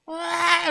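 high mock shriek; imitated scream. Dwarf imitates girl screaming again, high shriek. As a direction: squeal as the girl did.

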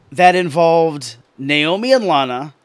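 ordinary delivery

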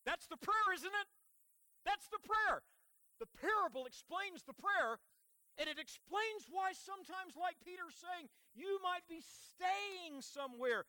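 high, loud, insistent preaching; emphatic preaching. Real, not acted. Preacher explains the prayer to the church, emphatic preaching. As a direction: drive it home from the pulpit, pitched high. Preacher driving the point home.

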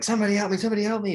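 panicked cry for help. Retold or imitated. Nemo stuck in the tank cleaner, mock panicked. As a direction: call out in mock terror. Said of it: "And he's like".

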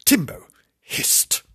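slow hissed words; hissed cat line; hissing. Acted. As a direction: hiss it like a cat. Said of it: "We just heard the hiss".

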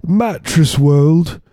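high-pitched cartoon Cockney rocker; exaggerated English accent, high and drawn out at the end; mock English accent. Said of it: "why they have English accents".

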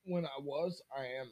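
teary, barely audible; crying from spicy food. Real, not acted. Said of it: "You're crying now".

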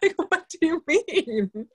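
high, slow baffled question; baffled disbelief. Retold or imitated. To her friend, mock baffled over movie order. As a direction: drag out the disbelief, playful.

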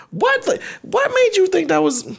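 high, slow disbelieving what; mock shocked question; incredulous. Incredulous quote.

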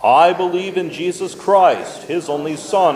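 ordinary talk

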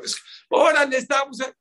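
high, loud party banter; acted boisterous pressing. Wedding banquet banter over tequila, high boisterous pressing.

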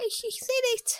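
high falsetto complaint; blinded woman. Acted. Sprayed woman whining to the boys in falsetto. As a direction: whine it high as the sprayed lady.

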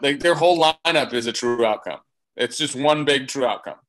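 ordinary talk, nothing marked

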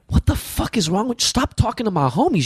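indignant protest, recounted; retold telling-off. Retells telling off a colleague.

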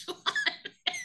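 high, slow laughing echo. Real, not acted. Repeating her co-host's odd phrase, laughing. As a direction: laugh the name back in delight.

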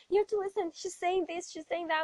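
high, eager pleading; a child begging the pastor to listen; urgent pleading. Retold or imitated.

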